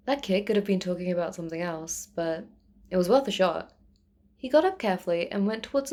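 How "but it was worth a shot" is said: usual speaking voice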